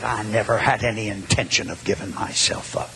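very low, grave answer; solemn confession. Retold or imitated. Caller explaining why he gave himself up, solemn low confession.

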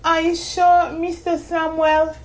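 put-on high girl voice through a yawn. A narrator's reading. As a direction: ask it mid-yawn, sleepy. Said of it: "she asked him with another yawn".

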